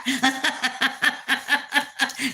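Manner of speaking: high, light laugh; real chuckle; amused laugh. Real, not acted. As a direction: laugh at yourself. Laughs at her new handle.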